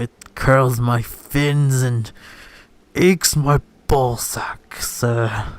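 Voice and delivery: slow, drawn-out British grumble; hammed-up British accent, very slow; mock British accent. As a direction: play an indignant British retainer, stretching each phrase. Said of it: "this is the best British accent".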